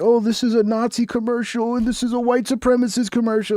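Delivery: high mocking whine of an outraged critic; mocking woke critic. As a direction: sneer it like an offended activist. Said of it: "So they're like".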